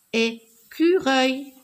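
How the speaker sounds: "Écureuil" slowed, broken as é-cu-reuil; "Écureuil" with a gap after the first syllable. Shown as a demonstration. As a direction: beat out every syllable of "Écureuil". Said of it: "je frappe les syllabes".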